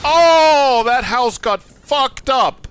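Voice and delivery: loud, high shocked yell; startled shout. Real, not acted. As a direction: blurt out the shock at full volume. House suddenly destroyed in game.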